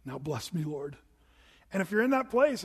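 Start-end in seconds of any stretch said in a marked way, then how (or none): 0.1-0.9 softer, lower quiet prayer of giving in; a meek, hushed plea; humble surrender. Retold or imitated. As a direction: hand it over to God gently. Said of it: "the Lord would have you say".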